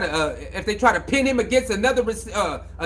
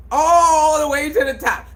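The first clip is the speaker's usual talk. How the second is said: louder, emphatic repeat; 5 semitones higher, 5 dB louder than usual. Shown as a demonstration. Repeating his point to the audience, louder and higher. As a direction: say it louder and higher the second time.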